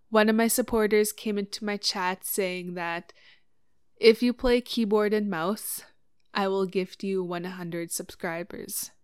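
ordinary delivery

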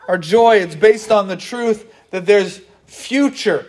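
ordinary talk, nothing marked

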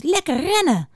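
high, eager hare voice; gleeful cry. A narrator's reading. Hare sets off running, gleeful cry. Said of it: "riep de haas".

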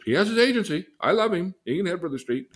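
high, loud mocking voice of a careless parent. Therapist mocking a careless parent, high and loud. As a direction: squeak it out like a parent who doesn't care. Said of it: "You're gonna say".

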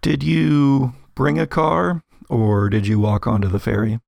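normal talking voice

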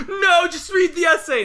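very high, loud desperate shout; frantic yell. Retold or imitated. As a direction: snap it out in a panic. Dying man shouts again.

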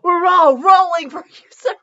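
high, loud laughing jab; real laughter pushing the voice up; cracking up. Real, not acted. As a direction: laugh it out at the story's expense. Reader mocks line, cracking up.

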